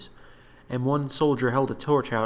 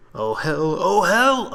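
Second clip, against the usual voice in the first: rising, louder second "oh hell"; panicking; 7 semitones higher, 7 dB louder, much slower than usual. Acted. Soldier panicking at the unseen beast, rising fear. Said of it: "panted, panicking".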